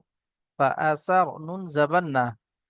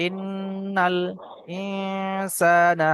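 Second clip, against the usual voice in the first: slow drawn-out Quran recitation; 3 semitones higher, much slower than usual. Shown as a demonstration. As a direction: chant each word slowly. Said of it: "teacher recites the verse".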